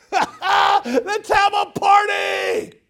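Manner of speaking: very high, stretched-out celebratory shout; jubilant yell. Acted. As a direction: holler it with a laugh, as an overjoyed father. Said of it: "but he yells".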